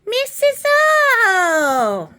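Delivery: loud, high, long drawn-out call. Pretend. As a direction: holler the name in a falling wail. Calling out to Missus O.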